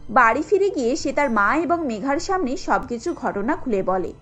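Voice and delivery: usual speaking voice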